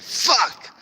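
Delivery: sudden high, loud outburst; real yell. Real, not acted. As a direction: yell the curse out in one burst. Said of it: "I yelled too loud".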